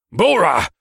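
high, loud barked call; barked name. A narrator's reading. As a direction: bark the name sharply. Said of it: "the orc captain barked".

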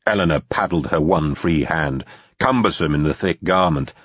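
ordinary delivery, nothing marked

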